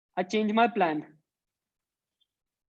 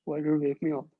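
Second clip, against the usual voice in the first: lower, drowsy complaining voice; groggy woken-up grumble, pitched down; sleepy low whine; 6 semitones lower than usual. Acted. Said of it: "he's like".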